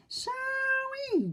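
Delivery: very high, quick squealed apology; high pig cry. Acted. Oinky diving into the pie, high squeal. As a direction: squeal it as you dive in. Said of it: "cried Oinky".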